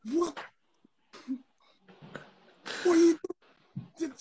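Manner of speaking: shocked yell. Retold or imitated. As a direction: yell it like a stunned dad. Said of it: "I was like".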